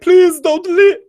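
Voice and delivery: very high, pleading falsetto whine; high-pitched begging whimper as Reek. Retold or imitated. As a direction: beg her not to go in a tiny high voice. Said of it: "Reek is like".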